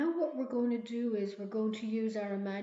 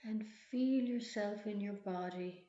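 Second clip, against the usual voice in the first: guided meditation. Acted. Leads a relaxation visualization.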